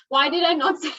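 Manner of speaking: breaking into a real laugh mid-sentence. Real, not acted. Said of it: "I'm sorry I'm laughing".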